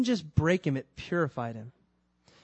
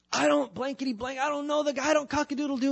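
frantic babble; 10 semitones higher than usual. Acted. Peter's garbled denial mocked.

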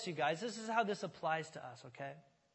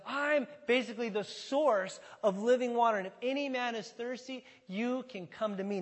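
higher voice, a mild crying out; raised proclamation; 5 semitones higher, 4 dB louder than usual. Acted. Preacher paraphrasing Jesus crying out, raised proclaiming voice. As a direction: call it out without a full shout. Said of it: "And Jesus cries out".

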